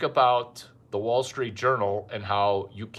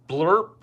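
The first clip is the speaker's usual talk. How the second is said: silly blurp sound; 6 semitones higher, 7 dB louder than usual. Acted. Imitated orgasm sound.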